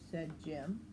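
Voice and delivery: plain reading voice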